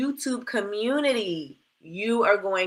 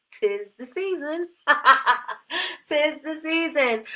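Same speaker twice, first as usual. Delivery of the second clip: amused, slow and laughing; 7 semitones higher than usual. Real, not acted. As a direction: chuckle through it. Pumpkin spice joke, laughing.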